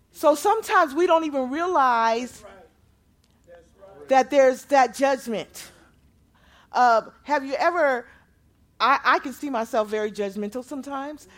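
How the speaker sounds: loud, high, rising sermon delivery; full-voiced revival preaching; preaching at full force. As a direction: preach it out to the whole room, building. Preaching on judgment.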